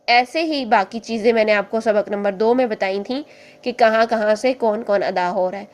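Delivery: usual speaking voice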